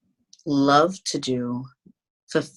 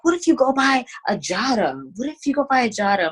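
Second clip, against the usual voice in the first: higher-pitched imitation of an eager mom; mom making suggestions; 9 semitones higher, faster than usual. Artist quoting mom's suggestions, higher mock mom voice. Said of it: "she's always, like, giving suggestions".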